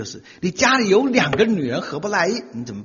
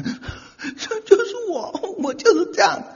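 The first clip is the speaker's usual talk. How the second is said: put-on very high, slow girlish voice with a laugh; mock girlish high voice, laughing; young girl identifying; slower than usual. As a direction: squeak it like a moved young girl. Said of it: "然后小姑娘讲".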